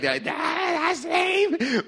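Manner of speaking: put-on very high, slow drawn-out teen voice; son's voice. Son mocking dad's joke, very high, slow and drawn out. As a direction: drag it out like a bored teenager. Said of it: "My son's like".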